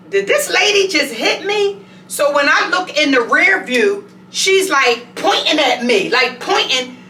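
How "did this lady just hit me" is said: high, stunned question; shocked disbelief. Retold or imitated. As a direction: retell the moment of disbelief, pitched up. Shocked she got rear-ended.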